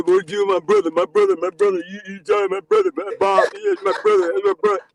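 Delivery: high, loud mock imitation of the coworker; imitating eighty-four. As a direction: ham up the coworker's chatter. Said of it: "That's what it sounds like to me".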